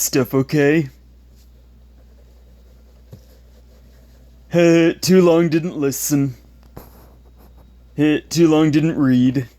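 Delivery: taunting schoolyard bully voice; mocking bully. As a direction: sneer it like a bully. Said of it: "the ones that I, I quoted".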